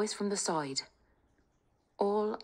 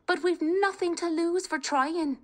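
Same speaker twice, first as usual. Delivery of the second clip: put-on higher, urgent young Kate; rally the family, high and eager; spirited young woman; 13 semitones higher, 5 dB louder than usual. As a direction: bold daughter talking her family round. Kate voiced.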